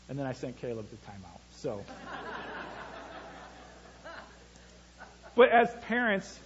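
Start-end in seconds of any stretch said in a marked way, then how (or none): 1.9-3.5 airy laugh. Real, not acted. Preacher laughs at own story.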